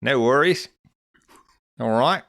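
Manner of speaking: playful Aussie banter; mock Australian. Continuing Australian bit.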